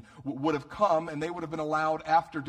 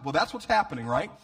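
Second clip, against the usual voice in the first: usual speaking voice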